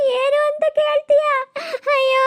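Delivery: high, loud tearful wail; wailing lament. Acted. Daughter wails high and loud to her mother about her misery.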